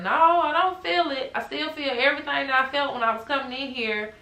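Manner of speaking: frustrated protest. Retold or imitated. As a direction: insist it isn't working. Morphine not working, arguing.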